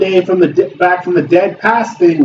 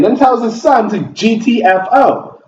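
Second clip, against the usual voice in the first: ordinary delivery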